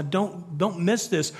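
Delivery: usual speaking voice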